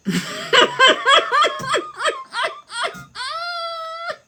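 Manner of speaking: high screaming laugh that nearly cries; a real wailing cackle; shrieking laugh. Real, not acted. Cracking up at herself.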